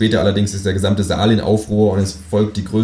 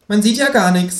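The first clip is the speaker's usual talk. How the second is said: much higher cheeky kangaroo voice; 13 semitones higher than usual. Acted. Kangaroo complaining about the dark restaurant, high cartoon voice. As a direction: pitch it way up like a cartoon animal. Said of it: "sagt das Känguru".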